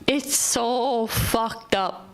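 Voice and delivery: very slow, each word stretched; drawn-out repeat. Shown as a demonstration. To viewers about rude drivers, slowly drawn-out lament. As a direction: let it sink in, dragging every word.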